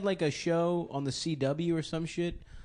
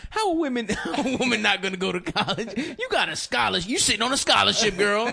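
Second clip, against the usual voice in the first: high, loud, laughing comic rant; Ari Spears impression; 7 semitones higher, 4 dB louder than usual. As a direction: imitate a loud stand-up bit. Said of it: "one of Ari Spears' bits".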